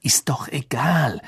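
brushed-off hushed whisper; quick whisper. Acted. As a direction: dismiss it under your breath. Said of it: "sagte Harry rasch".

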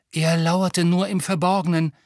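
ordinary delivery, nothing marked

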